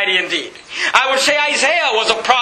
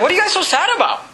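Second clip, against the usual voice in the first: higher-pitched acted question; curious stranger butting in, voiced higher; puzzled questioning; 7 semitones higher, much faster than usual. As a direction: ask it innocently, pitch lifted. Acts out Jesus questioning disciples.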